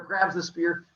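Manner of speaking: ordinary delivery, nothing marked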